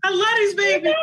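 loud, high, excited declaration; loud happy gush. Real, not acted. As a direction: burst out with love for them. Gushing about the kids.

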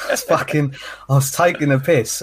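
ordinary talk, nothing marked

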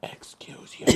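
whispering. Real, not acted. Teasing reply to excuse me.

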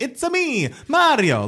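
high-pitched cartoon Italian accent; sing-song Italian caricature voice; Mario's Italian accent. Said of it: "you can tell me as an Italian, is it offensive?".

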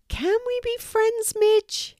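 very high, hopeful cat; high-pitched pleading cartoon cat; friendly cat character. A narrator's reading. Cat asks mouse for friendship.

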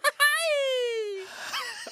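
very high falsetto yelping laugh; mock Mexican laugh. Asked for the Mexican laugh, a shrill hammed-up yelp. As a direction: cackle it like a cartoon mariachi. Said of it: "do that Mexican laugh".

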